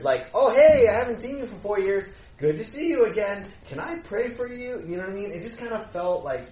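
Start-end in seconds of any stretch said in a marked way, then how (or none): 0.3-4.8 much higher and louder, forced cheery greeting; awkward fake cheer. Pastor imitating his own awkward greeting to relatives, forced cheer. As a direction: overdo the bright hello to hide awkwardness. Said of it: "now I felt weird".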